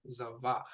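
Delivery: Zavach, "za" then "vach"; Hebrew word said slowly. Shown as a demonstration. Teacher modelling Zavach for the learner, slow and clear. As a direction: sound out Zavach clearly for a student. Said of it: "first a kamatz and then a patach".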